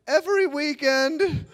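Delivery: high, drawn-out fib; obvious bluff. Retold or imitated. Bluffing about drug experience.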